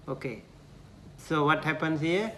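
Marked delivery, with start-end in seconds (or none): none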